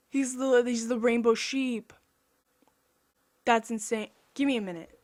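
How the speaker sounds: ordinary delivery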